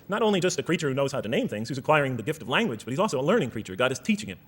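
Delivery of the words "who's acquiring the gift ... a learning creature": ordinary delivery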